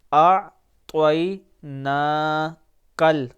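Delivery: اعطیناکل spelled out slowly with a heavy ط; teacher drilling Quranic syllables. Shown as a demonstration. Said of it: "طوا ہے".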